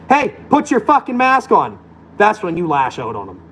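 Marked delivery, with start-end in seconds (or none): 0.1-1.8 mock yell. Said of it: "someone yells at you and says".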